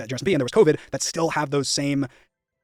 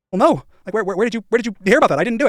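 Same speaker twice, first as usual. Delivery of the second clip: flustered denial; 8 semitones higher, 6 dB louder than usual. Retold or imitated. Acted phone call defence.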